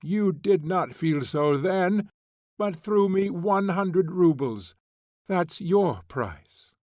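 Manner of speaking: loud, high, slowing to a crushing end; bitter accusation. A narrator's reading. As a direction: drag out the last words. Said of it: "angrily mimicking him".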